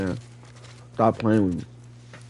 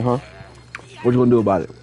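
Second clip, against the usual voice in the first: normal talking voice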